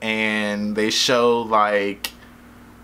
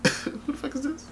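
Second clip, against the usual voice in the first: high, hushed questioning; soft, high-pitched comic disbelief; mock incredulous murmur; 13 semitones higher, 7 dB quieter than usual. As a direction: imitate a baffled onlooker whispering to a neighbor. Imitating world leaders doubting the presenter.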